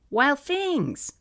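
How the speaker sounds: put-on high eager little-kid voice; toddler asking. Mom imitating her son asking for his favorite book, high toddler voice. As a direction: ask for the book like a two-year-old. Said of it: "which you ask for by saying".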